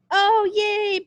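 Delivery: high, loud delighted "Oh, yay!"; excited cheer. Acted. A fan thrilled the concert is Beethoven, cheering loudly. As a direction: squeal it with joy.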